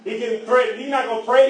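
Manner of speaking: normal talking voice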